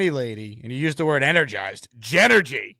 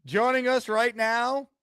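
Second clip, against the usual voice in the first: hype announcer intro; 3 dB louder than usual. Acted. Host hypes the caller's entrance.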